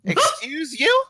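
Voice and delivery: high, offended squeak; indignant high excuse you. Acted. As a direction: shoot it back, scandalized. NPC offended again, louder.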